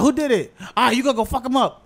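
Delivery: high, loud riled-up parent; angry parent. Retold or imitated. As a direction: play a parent egging the kid on. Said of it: "Your parents would be like".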